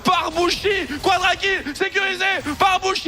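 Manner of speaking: rising, shouted play-by-play hype; esports caster. Real, not acted. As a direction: build the hype as the kills land.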